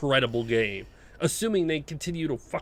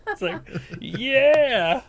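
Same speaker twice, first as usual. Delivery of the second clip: drawn-out amused yeah; 9 semitones higher, 4 dB louder, much slower than usual. Real, not acted. Laughing at invisible car bug.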